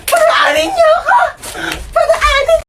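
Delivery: very high screamed demand; frantic shout. Acted. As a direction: scream to be let through. Final escalated demand to pass. (pasigaw na pagpupumilit)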